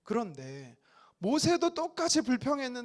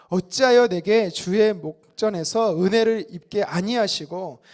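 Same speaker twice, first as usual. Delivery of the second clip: slow, much louder unison Bible reading; loud group scripture reading; 9 dB louder than usual. Acted. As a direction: read it out together, slow and loud. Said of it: "같이 한번 읽어보도록 하겠습니다". (회중과 함께 크고 느리게 성경 봉독)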